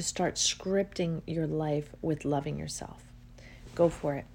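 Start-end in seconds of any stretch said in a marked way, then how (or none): none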